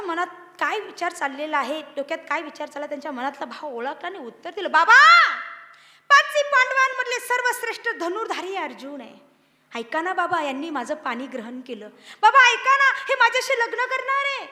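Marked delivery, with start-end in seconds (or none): 4.7-14.5 high, loud, eager plea to father; eager pleading daughter. Acted. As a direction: coax father excitedly. (मुलगी उत्साहाने वडिलांना विनवते)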